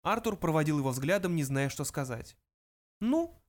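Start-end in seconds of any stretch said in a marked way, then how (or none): 3.0-3.4 high little mouse voice; high-pitched small creature. A narrator's reading. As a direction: open business as a tiny mouse. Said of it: "произнёс Бенджи".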